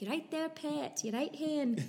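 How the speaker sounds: friendly Glasgow or Edinburgh lilt; Edinburgh-Glasgow Scottish accent. Shown as a demonstration. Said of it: "the normal Scottish accent from Edinburgh or Glasgow".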